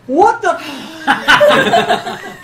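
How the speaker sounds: high, loud, drawn-out disbelief; flabbergasted. Acted. Town elder arriving at the chaotic scene, flabbergasted. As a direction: yell it in hammed-up shock. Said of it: "he's just flabbergasted".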